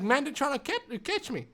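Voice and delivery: a touch of tearful fear; retold near-crying plea; mild crying. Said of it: "I was kind of crying".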